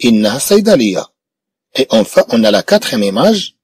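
ordinary delivery

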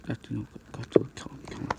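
hushed, quick whispered prompting; urgent whisper. Pretend. Urging Godzilla to kill the foe, whispering. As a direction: whisper it fast under your breath.

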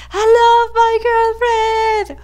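high, loud declaration of love; hammed-up lovestruck shout; joyful yell. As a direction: yell it out for everyone to hear. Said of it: "so I can yell".

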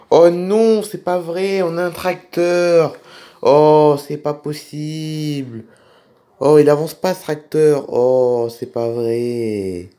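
drawn-out groaning dismay, loud bursts on each "oh"; exasperated. Acted. Driver stuck behind a tractor, exasperated and drawn out. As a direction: stretch the complaint out in disbelief.

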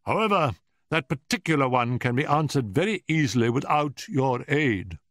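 high, pointed, testy Professor; haughtily dispense with the visitor's help; asperity. A narrator's reading. Professor dismisses Holmes's refusal to answer, with asperity. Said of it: "said the Professor with asperity".

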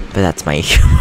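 normal talking voice

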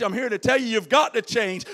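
ordinary talk

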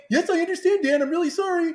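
feed the line in a pleading tone; dictated apology. Shown as a demonstration. Host dictating an apology for Mister Moon, mock meek voice.

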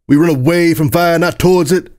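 exasperated Flair. Acted. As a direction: scold a friend in urgent alarm. Flair scolds dazed narrator amid danger.

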